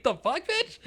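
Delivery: very high squeal of outrage, half laughing; mock indignant disbelief. Customer replaying shock at the clerk, hammed-up outrage. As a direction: play up the shocked question, pitch way up.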